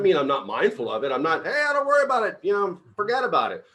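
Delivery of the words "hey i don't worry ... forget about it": mock carefree. Mocking carefree attitude.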